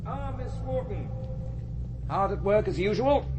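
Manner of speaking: high, cheery hello; lifted pleased welcome; bright greeting. Real, not acted. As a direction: greet her with sudden brightness. Greeting a parishioner.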